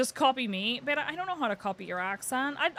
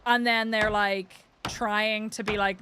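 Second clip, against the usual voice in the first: ordinary delivery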